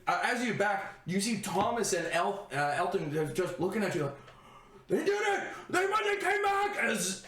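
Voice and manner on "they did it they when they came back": excited cheer. Acted. Guards cheer the returning party, excited shout. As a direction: shout it out in celebration.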